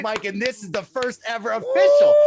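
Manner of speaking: booming livestream host opener; hype host intro. Retold or imitated. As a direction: pump up the room like a show host. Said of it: "And I turn and I go".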